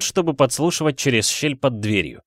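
plain reading voice

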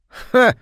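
sharp contemptuous "Ха!" shouted high; a loud derisive snort of a laugh; scornful shout. A narrator's reading. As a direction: bark out a scornful ha. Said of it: "крикнул муж".

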